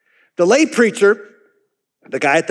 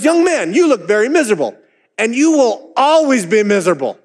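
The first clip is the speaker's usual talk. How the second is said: higher, pressing, rising warning; preacher's warning; 4 semitones higher than usual. Retold or imitated. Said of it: "He says".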